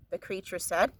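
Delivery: ordinary delivery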